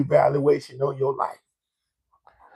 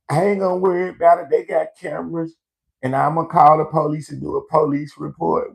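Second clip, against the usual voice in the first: mocking the cashier; 6 semitones higher than usual. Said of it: "guess what she said".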